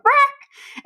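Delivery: high squeaky quack, like a silly frog; comic high-pitched frog quack; frog quack imitation. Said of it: "They went".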